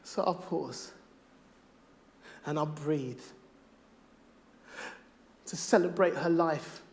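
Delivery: rhythmic performed poem, swelling from hushed to loud; spoken word poem. Acted. Poet to the audience about his stillborn daughter, spoken word. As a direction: deliver it like a slam poem.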